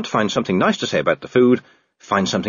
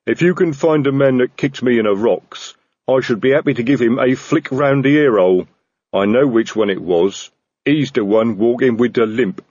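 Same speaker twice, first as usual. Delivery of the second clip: troll voice; 2 semitones higher, 3 dB louder than usual. Acted. Troll speaking.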